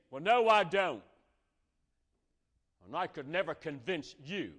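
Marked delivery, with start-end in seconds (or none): 0.1-1.0 louder, higher snapping retort; retaliating denial. Pretend. Church member hitting back at the pastor, loud. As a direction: fire back, defensive and sharp. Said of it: "you could retaliate and say".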